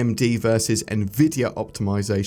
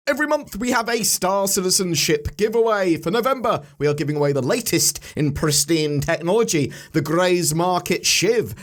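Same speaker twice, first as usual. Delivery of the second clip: upbeat outro read; 5 semitones higher than usual. Acted. Channel outro and giveaway read.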